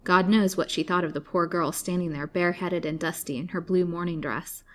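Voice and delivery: plain reading voice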